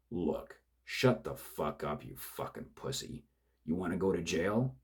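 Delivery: bully him into silence; angry thug. Acted. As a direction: killer yelling at his crying partner to shut up. Killer shouts down partner.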